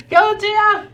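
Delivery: high-pitched wail for a fallen comrade; dramatic cry. Pretend. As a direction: call out in mock anguish. Calling out to fallen party member.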